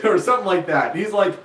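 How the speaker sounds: ordinary talk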